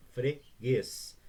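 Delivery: "Freguês" in two loud, separate syllables. Shown as a demonstration. Teacher showing that G and U stay together, syllable by syllable. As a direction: say "Freguês" as fre, pause, guês. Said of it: "eu não posso separar o G do U".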